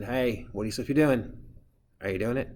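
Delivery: loud classroom check on a student; teacher calling out. Acted. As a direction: call across the room to pull them back.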